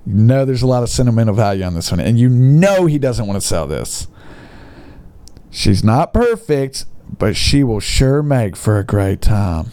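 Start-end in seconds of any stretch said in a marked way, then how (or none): none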